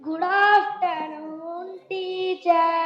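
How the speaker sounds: very slow sing-song greeting; drawn-out schoolroom chant; classroom chorus greeting. Acted. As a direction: drag out each word like pupils greeting teacher.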